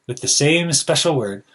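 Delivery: ordinary delivery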